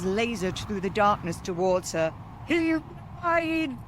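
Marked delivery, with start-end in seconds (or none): 2.5-3.8 high screeching warning; high witch screech. Acted. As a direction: shriek it to warn them. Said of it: "she screeched in warning".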